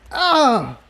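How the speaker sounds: sharp high cry of pain. Acted. Spike cries out as pain shoots through his head. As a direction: yelp as pain hits the head.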